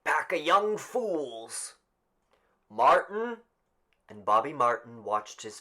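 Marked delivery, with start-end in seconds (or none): 0.0-1.8 put-on grumpy old man. 2.7-3.4 higher, louder call; raised roll call; calling out a name. Acted.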